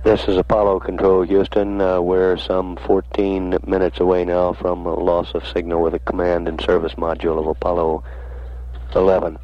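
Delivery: crackly distant radio transmission; announcer over a static-filled radio link; breaking up space radio. Real, not acted. Mission control radio commentary.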